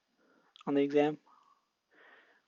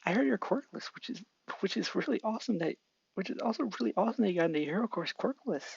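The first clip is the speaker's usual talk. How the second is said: higher, fast eager voice; admiring Izuku; 7 semitones higher, faster than usual. Acted. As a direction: gush out the compliment quickly. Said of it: "Izuku complimenting Kopen".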